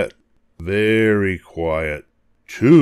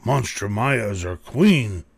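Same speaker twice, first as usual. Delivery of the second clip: usual speaking voice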